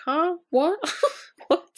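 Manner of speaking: high-pitched puzzled questions breaking into laughter; mock bafflement. Imitating Tracy cut off by Evie, baffled. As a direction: imitate someone not getting it at all.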